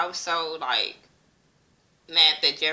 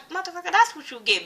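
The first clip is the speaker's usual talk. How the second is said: gloating; 11 semitones higher, faster than usual. Retold or imitated. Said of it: "But I was like".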